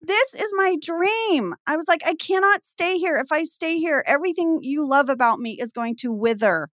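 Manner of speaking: high, impassioned re-enacted plea; dramatic pleading. Daughter begging parents to let her go, acted drama.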